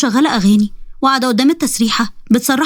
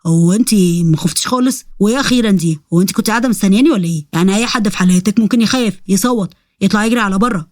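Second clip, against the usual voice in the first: low demon voice, incredulous; astonished low-pitched demon; Gamra, very puzzled; 3 semitones lower than usual. Acted. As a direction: question her in a lowered, baffled voice. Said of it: "وهو مستغرب جدًا".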